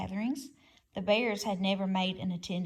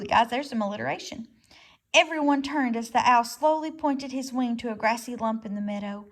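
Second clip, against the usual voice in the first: plain reading voice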